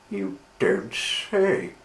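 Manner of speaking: slow, drawn-out amazement; surprised exclamation. Acted. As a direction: draw it out in disbelief. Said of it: "he exclaimed".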